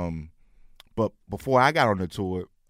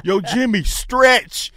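calling out; 11 semitones higher, 11 dB louder, much slower than usual. Retold or imitated. Calling out to comic onstage.

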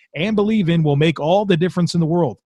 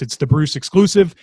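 ordinary talk

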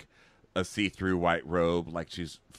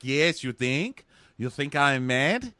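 put-on slow, high Russian-accented questions; drawn-out mock Russian indignation, rising at the end; 6 semitones higher, 4 dB louder, slower than usual. [name] throwing Dean's words back, mock Russian accent. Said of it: "Is that a Russian thing, just answering in questions?".

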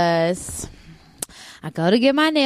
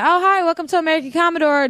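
higher, quick, chirpy customer-service greeting; chirpy greeter voice; 7 semitones higher, faster than usual. Retold or imitated. Greeting customers at the store, bright and quick. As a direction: play the cheerful store greeter. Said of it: "I used to be like".

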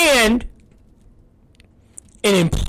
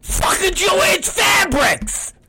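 high, slow, strained mock yell; retold fury; 10 semitones higher, slower than usual. Dad re-enacting how mad he was in Joann's Fabrics, high yelling. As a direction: stretch it into a high exasperated cry. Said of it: "Fuck, I was mad".

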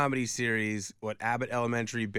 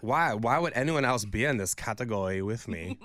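a light, haughty put-on Anna Delvey accent; lightly voiced Anna Delvey accent, sniffing at rivals. Host mocks the nominees in a light Anna Delvey accent. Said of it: "Such a good impression of Julia Garner".

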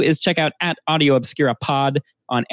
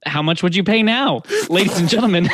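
infomercial; 6 semitones higher, 4 dB louder than usual. Acted. Host mimics a sales pitch.

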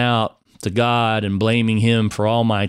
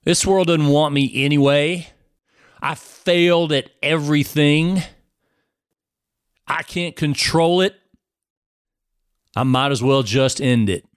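retold desperate cry; 5 semitones higher than usual. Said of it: "I screamed out a prayer".

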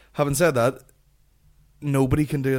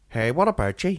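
higher, mimicked learner's Belfast accent; Korean Billy; 4 semitones higher, 4 dB louder than usual. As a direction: imitate an overdone Belfast greeting. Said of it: "Korean Billy".

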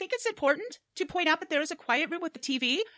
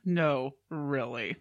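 deadpan narrator; 5 semitones lower, slower than usual. Acted. Narrator time-skip with laugh.

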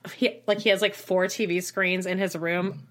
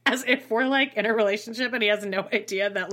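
ordinary delivery

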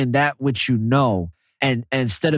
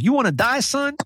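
higher, put-on tough-guy taunt; mock threat; 11 semitones higher than usual. Retelling the store robbery scene, high mock threat. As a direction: play the gunman daring him.

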